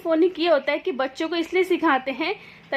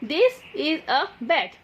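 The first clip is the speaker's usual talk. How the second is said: slow example sentence; much slower than usual. Shown as a demonstration. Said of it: "ठीक है".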